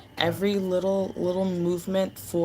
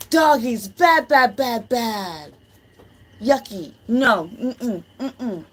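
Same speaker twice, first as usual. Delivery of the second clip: high, loud pet-scolding voice; disapproving sing-song scolding; scolding dogs; 7 semitones higher, 7 dB louder, much slower than usual. Real, not acted. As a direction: scold the doggies.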